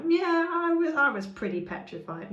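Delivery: hammed-up nonchalance; mock casual. Shown as a demonstration. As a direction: shrug it off like it's nothing. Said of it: "You don't just want to be like".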